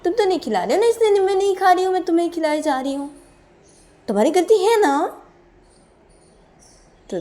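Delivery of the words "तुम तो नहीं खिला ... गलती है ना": very high, loud accusing rant; acted angry complaint. Character who keeps feeding partner blames them, loud and aggrieved. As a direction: pile on the blame, shrieking high.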